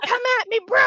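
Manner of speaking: high-pitched playful taunt; mock challenge. Player voices the new tank character daring enemies, playful high taunt.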